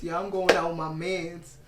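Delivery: a hint of a laid-back dude; man's voice. Shown as a demonstration. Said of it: "let me do my man's voice".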